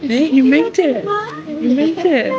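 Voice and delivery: high, loud excited pet praise. Real, not acted. Praising dog.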